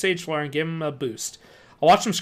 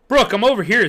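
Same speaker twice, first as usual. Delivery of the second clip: louder call; 5 semitones higher, 8 dB louder than usual. A narrator's reading. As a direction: call out from behind. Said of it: "Zeke called out".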